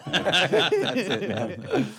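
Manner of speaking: high, loud words broken by real laughter; laughing. Real, not acted. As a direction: laugh through it, giving up on the drums. Laughs at his drumming failure.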